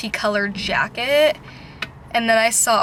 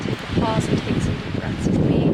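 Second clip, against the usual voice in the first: calm guided breathing. Real, not acted.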